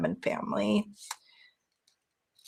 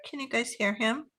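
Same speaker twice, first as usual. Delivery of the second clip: ordinary delivery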